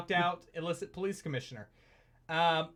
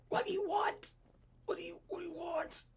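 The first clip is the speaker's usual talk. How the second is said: panicked choked pleading; 12 semitones higher, 9 dB quieter than usual. Acted. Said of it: "flailing commissioner".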